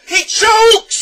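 very high, piercing yell; joyful shout. A narrator's reading. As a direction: shout it, jumping for joy. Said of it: "he shouted again, jumping for joy".

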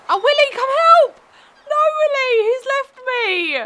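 high, loud cry for help; panicked call. Real, not acted. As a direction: wail after someone who left you. Calls for help in the game.

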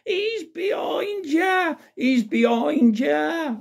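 high, drawn-out mock shout; panto audience shout. Panto audience warning Buttons of the ghost, high mock shouting. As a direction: imitate kids calling out, very high and slow. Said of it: "the usual loud shouts of".

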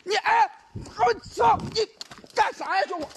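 very high, slow startled swear; startled curse. Real, not acted. Streamer jolted by the ghost, slow high startled curse. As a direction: jolt and curse in shock.

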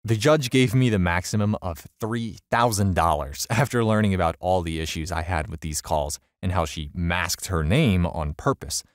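plain reading voice

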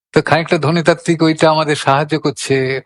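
ordinary talk, nothing marked

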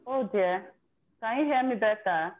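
ordinary talk, nothing marked

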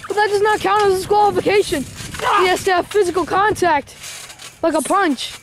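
high excited ringside call; wrestling commentator. Acted. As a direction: shout the count like a commentator. Live wrestling play-by-play.

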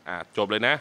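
ordinary talk

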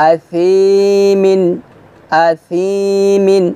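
আসিমিন chanted very slowly, each sound held; drawn-out recitation. Shown as a demonstration. Teacher chanting আসিমিন for students to repeat. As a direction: stretch আসিমিন into a slow chant. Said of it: "হালকা নরম বাতাস বের করবেন".

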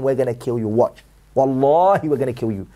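low, menacing voiced threat, a touch hammed; mock threat. Acted threat to the disbeliever.

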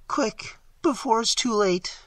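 high, tense plea without the scream; resigned but anxious, pitched high; hopeless resignation. A narrator's reading. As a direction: urge a friend on, all hope gone. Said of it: "a softer quality as of hopeless resignation".

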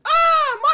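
very high, loud "aah"; high-pitched cry; high scream. Pretend. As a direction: scream out suddenly. Cries out at Michael Sting.

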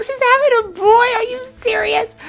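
very high, thrilled disbelief; retold excitement. Recalling her own reaction to the news, squealing high. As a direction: squeal it like you can't believe it. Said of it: "like, so excited".

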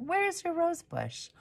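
a curious neighbor, high and hushed; gentle high puzzled lady; Miss Turner's voice. A narrator's reading. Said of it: "said Miss Turner".

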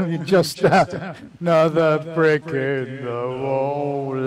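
put-on sung slowly in a Cockney accent. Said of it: "My best Cockney accent".

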